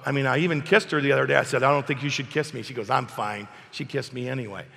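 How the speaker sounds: ordinary talk, nothing marked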